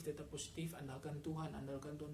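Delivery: low, soft, calming repetition; soothing wife. Retold or imitated. Wife calming her crying husband, soft and low. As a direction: gently hush him like a worried spouse.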